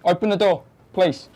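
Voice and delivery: loud call through a door. Real, not acted. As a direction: call out to someone behind a door. Police calling through door.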